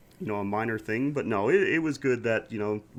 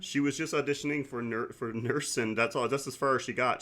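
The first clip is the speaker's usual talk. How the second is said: ordinary talk, nothing marked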